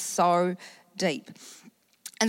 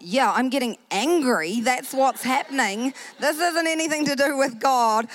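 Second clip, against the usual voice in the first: louder and higher, heated retort; getting angry; 7 semitones higher, 5 dB louder than usual. Retold or imitated. Woman snapping at the lady praying over her, heated and louder. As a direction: snap back, the anger boiling over. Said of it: "feel myself getting hotter and hotter".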